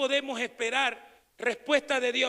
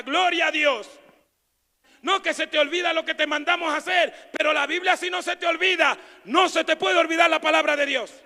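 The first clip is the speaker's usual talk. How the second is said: loud preaching; 5 semitones higher, 8 dB louder than usual. Acted. Preaching at full force.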